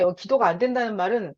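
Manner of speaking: ordinary talk, nothing marked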